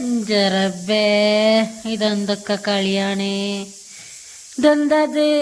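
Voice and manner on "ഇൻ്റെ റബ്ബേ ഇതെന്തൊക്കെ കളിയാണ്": low, slow exasperated groan. Real, not acted. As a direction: drag it out in disbelief. Exasperated at child's antics.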